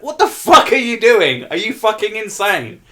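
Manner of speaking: mock outraged scolding; high, loud incredulous telling-off; Gordon Ramsay. Acts Gordon Ramsay scolding Steve-O.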